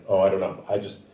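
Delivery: normal talking voice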